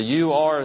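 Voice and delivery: "You are" with weight on "You"; emphatic you are. Retold or imitated. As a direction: point a finger with "You". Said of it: "and this is emphatic".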